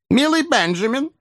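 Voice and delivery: put-on very high old-lady voice; an old lady a touch overcome, pitched right up; trembling old lady. A narrator's reading. Old lady addressing nephew, agitated high voice. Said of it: "стараясь отдышаться и дрожа".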